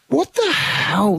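high, slow what the hell; mock shock at grainy conditioner. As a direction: drag it out in disbelief.

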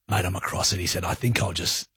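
low, soft imitation of a mate; hushed, matter-of-fact reassurance; low-key aside. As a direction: drop down low and quiet, quoting him. Acting out Eddie's discreet reply.

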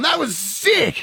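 high, slow, stretched thrill. Retold or imitated. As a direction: savour how sick it was. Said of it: "Driving home going".

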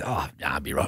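low, soft brush-off; declining the baby. Retold or imitated. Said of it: "And I go".

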